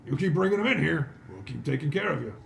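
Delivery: big-voiced constable's praise; hearty dwarf constable. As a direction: send off a bounty hunter heartily. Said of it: "He goes".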